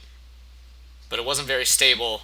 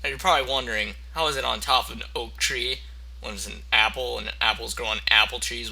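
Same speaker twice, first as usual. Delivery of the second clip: normal talking voice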